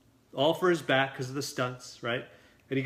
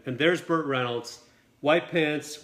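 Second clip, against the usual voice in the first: usual speaking voice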